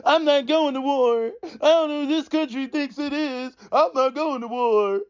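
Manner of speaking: high, crying mock whine; whining, crying mock. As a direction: whimper like a spoiled complainer. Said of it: "Crying, whining, fussing".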